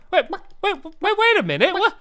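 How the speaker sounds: high, flustered stammer; hammed-up, high-pitched shopkeeper confusion; mock bewilderment. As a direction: play utterly baffled by the new customer. Shopkeeper finds chicken replacing cow.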